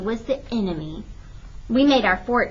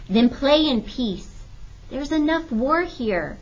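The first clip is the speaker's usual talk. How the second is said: slow, weary motherly voice; gentle reproach; 5 semitones higher, slower than usual. A narrator's reading. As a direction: say it slowly, tired of war.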